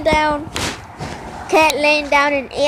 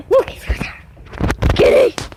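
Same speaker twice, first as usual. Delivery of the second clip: hushed excited whisper; 8 semitones higher than usual. Real, not acted. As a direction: whisper so the cat won't hear. Hushing near the cat.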